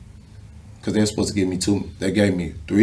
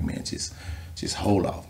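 hushed coach plea; 9 dB quieter, slower than usual. Retold or imitated. Other coaches calming him down.

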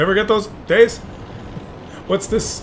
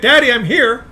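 put-on higher, plaintive little-kid voice; child calling to daddy; 5 semitones higher than usual. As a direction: play a child reporting to daddy. Plays player as child.

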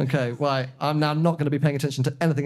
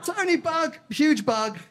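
higher, jittery panic about the bug; half-laughing alarm at a huge bug; nervous squeal; 9 semitones higher than usual. Real, not acted. On stage spotting a huge bug, high nervous alarm.

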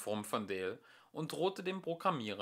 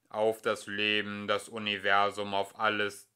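lower, weighty machine listing; ponderous computer; 3 semitones lower, 6 dB louder than usual. A narrator's reading. Computer repeats the question.

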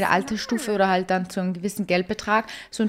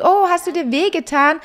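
higher, louder caring mother checking in; caring mom; 8 semitones higher, 6 dB louder than usual. Acted. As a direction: voice the mom asking her daughter, concerned. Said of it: "die Mom ... sagt". (die Mutter, höher und lauter, besorgt fragend)

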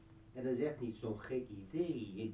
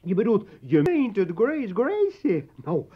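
loud mockery; 5 semitones higher, 14 dB louder than usual. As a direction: taunt her with exaggerated delight. Spot met haar uitval. (luid spottend)

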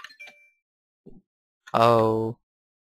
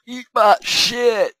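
mock villain voice; much slower than usual.

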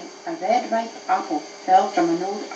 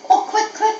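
high, louder, urgent wail; groaning plea; 13 semitones higher, 5 dB louder than usual. Acted. Little dog in pain hurries his friend, acted groaning. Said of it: "groaned the little dog".

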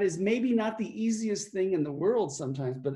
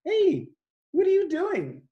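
friend calling out; 11 semitones higher, 4 dB louder than usual. Retold or imitated. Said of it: "He goes".

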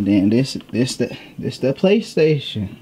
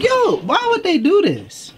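high, slow, disbelieving; drawn-out amazed whine; stunned disbelief; slower than usual. Retold or imitated. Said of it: "I was like".

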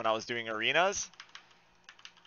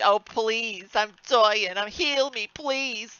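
higher, whiny plea; pleading for heals; 8 semitones higher, much slower than usual. Real, not acted. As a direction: beg the healer to save you. Dying, begging healer.

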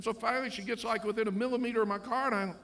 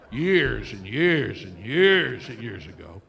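teasing drawn out; 11 semitones lower, 9 dB louder, much slower than usual. Retold or imitated. Teasing wife's age.

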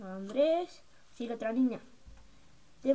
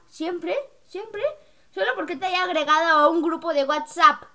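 loud retold complaint; acted-out scolding; 3 semitones higher, 8 dB louder than usual. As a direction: argue back loudly, imitating a friend. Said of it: "ayer me dijo en la coreografía".

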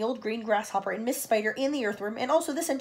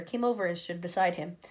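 plain reading voice

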